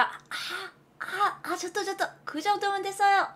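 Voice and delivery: high, small stammering protest; soft squeaky hesitant refusal; flustered refusal. Acted. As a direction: stop a generous server, all fluster. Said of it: "그때 내가".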